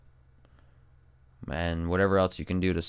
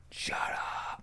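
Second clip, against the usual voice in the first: breathy whispered hush; 10 dB quieter than usual. Pretend. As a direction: whisper it sharply. Mock whisper at outside noise.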